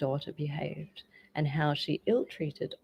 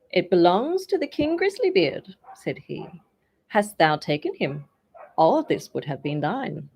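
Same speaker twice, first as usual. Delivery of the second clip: teasing musician; 8 dB louder than usual. A narrator's reading. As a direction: rub it in gently. Musician taunts her.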